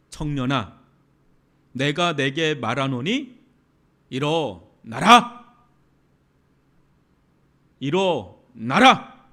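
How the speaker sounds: drawn-out commanding call, rising at the end; Jesus' command. Retold or imitated. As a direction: command the dead youth to get up, slowly. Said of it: "그때 하시는 말씀이".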